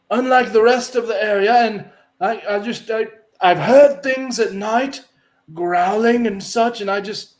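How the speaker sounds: high, pious priest voice; a fussy holy man, busy but polite. Acted. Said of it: "he stops and goes".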